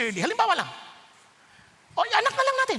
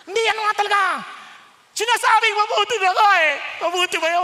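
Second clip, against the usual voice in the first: raised, loud preaching challenge; forceful challenge; 6 semitones higher, 8 dB louder, much faster than usual. Acted. Preacher pressing his listeners, loud challenge. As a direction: press the false fine answer hard.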